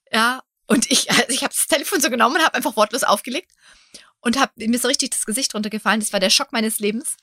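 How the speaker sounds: normal talking voice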